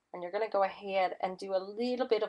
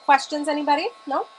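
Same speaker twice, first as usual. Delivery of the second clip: higher, louder call to the room; 6 semitones higher, 8 dB louder than usual. Real, not acted. Host calls out to viewers for questions, louder. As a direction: throw it out, hoping for hands.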